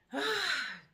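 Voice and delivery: long high sigh, welling up; emotional sigh. Real, not acted. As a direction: let out a heavy, moved sigh. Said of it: "I can't get emotional".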